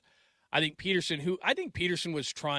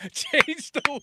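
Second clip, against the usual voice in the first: high, loud, laughing through the words; laughing joke; 6 dB louder, slower than usual. Real, not acted. Joking with co-hosts about the son, laughing high and loud. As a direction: toss it off mid-laugh.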